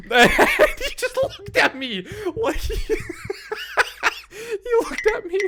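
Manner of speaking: high, squeaking words through laughter; wheezy laughing fit; helpless laughter. Real, not acted. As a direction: struggle to talk while cracking up. Cracking up while telling.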